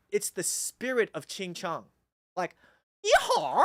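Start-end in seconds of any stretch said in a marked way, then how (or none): 2.8-3.6 high, loud mainland Mandarin twang; mainland Chinese accent. Shown as a demonstration. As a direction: exaggerate the mainland accent, pitched way up. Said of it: "this accent".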